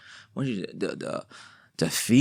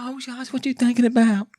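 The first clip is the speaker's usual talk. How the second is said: imitated woman on phone; 5 dB louder than usual. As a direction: squeal it sweetly, way up high. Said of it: "And she's like".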